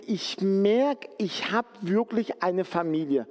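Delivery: ordinary talk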